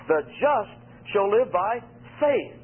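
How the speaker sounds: slow, with "faith" landing strong at the end; the weight falls on "faith"; stress on FAITH. Shown as a demonstration. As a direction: build to "faith" and set it down firmly. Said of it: "the big word you're to zero on here is faith".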